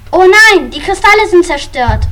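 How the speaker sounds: high, loud cry of dismay; shocked cry. Acted. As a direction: gasp it out in shock. Crystals destroyed, dismay.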